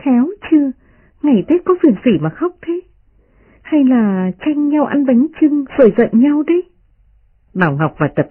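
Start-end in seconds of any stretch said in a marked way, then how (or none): 0.0-6.6 much higher, playful mocking girl; teasing young lady. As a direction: tease them with a laughing lilt. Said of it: "Đại Ngọc cười nói".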